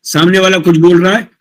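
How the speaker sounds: ordinary delivery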